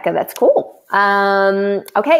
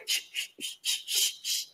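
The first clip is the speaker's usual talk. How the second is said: whispered shh; playful shushing; 15 dB quieter than usual. Real, not acted. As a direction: hush yourself playfully. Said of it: "hushing herself mid-celebration".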